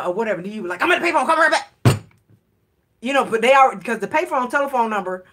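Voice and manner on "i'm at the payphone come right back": said fast. Retold or imitated. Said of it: "you gotta say it fast".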